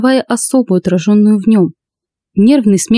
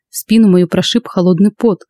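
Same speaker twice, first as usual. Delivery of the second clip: ordinary delivery, nothing marked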